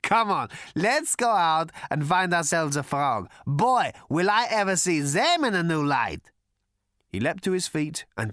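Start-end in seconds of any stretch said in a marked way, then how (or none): 0.0-6.3 high and loud, giddy; gleeful stoned hermit. Acted. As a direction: bubble over with silly glee. Prak gleefully wants frogs.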